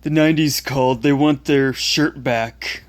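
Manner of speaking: taunting schoolyard bully voice; mocking bully. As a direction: sneer it like a bully. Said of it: "the ones that I, I quoted".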